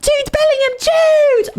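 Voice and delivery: very high, loud fan shrieks; hammed-up squealed calling; screaming fan guy. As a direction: imitate a frantic fan screaming out. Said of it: "that guy that was like".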